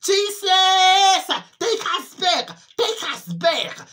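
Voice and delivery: loud, high wail that fades on the last plea; tormented people shouting to be taken back; desperate shout. Retold or imitated. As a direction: howl out to Jesus, then let it sink. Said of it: "They shouted".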